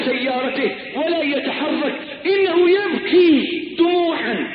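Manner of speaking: sermon tale climbing in pitch; dramatic preaching. Acted. Preacher tells of a man mourning his car, dramatic rising voice. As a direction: build the story to a high, incredulous cry.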